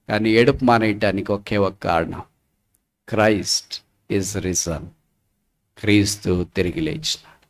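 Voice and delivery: low, soft, weighty proclamation; solemn preaching. Real, not acted. Preacher proclaims the risen Christ to the weeping, solemn and low. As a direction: bring it down to a grave hush.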